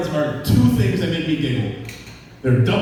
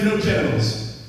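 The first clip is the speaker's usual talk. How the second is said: ordinary talk, nothing marked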